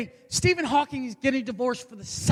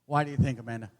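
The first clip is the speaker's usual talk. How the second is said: usual speaking voice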